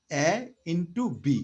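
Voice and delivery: drawn-out slow dictation of A into B; slow, deliberate. Shown as a demonstration. Teacher writing the area formula for students, slowly. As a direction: spell it out very slowly for the class. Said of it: "আস্তে আস্তে বলতেছি".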